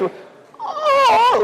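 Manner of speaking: high-pitched drawn-out "oh", hammed up; mock touched gasp. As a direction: imitate someone moved to tears. Acts her moved, tearful reaction.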